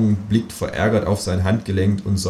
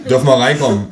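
lightly voiced insistent officer, a bit louder; a hint of a pushy policeman; 4 semitones higher, 6 dB louder, faster than usual. Police at the door asking to come in, lightly voiced officer.

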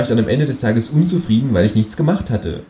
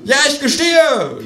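high, loud mock confession; mock shout; 5 dB louder than usual. A narrator's reading. Känguru mockingly confesses to the policeman, loud and high. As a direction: cry it out in mock guilt. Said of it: "schreit das Känguru".

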